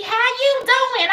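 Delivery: high, loud Southern greeting; Georgia accent. Retold or imitated. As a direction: imitate an effusive Georgian greeter. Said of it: "And they be".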